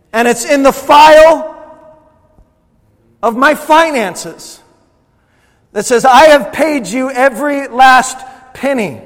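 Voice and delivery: loud, high, emphatic declaration; high-pitched preacher's proclamation; full-force preaching. Real, not acted. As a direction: hammer it out to the congregation.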